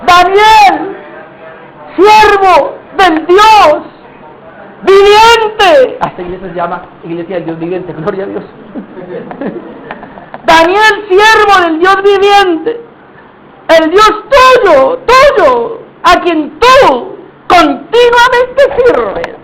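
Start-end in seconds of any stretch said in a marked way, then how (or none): 0.1-6.0 high, slow, mournful calling out; sad shouting. Acted. King shouting to Daniel in the pit, sorrowful cry. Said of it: "A gritos, pero con voz triste". 10.4-19.4 high, drawn-out pleading wail; sad shouting. Acted. King calling the servant of the living God, tearful shout. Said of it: "A gritos, pero con voz triste".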